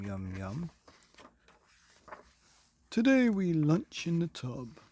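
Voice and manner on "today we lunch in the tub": louder, higher king's proclamation; booming king. Acted. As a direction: announce it grandly as the king. King answers in his voice.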